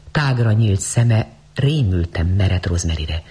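ordinary delivery

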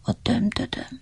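faint whisper fading away. Acted. Voice fading weakly from under the tree, whispered. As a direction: let it die out under the tree. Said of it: "egyre halkabban jött".

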